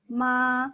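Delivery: "ma" sustained on one level pitch; first tone, flat. Shown as a demonstration. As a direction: hold "ma" perfectly even. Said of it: "it's very flat".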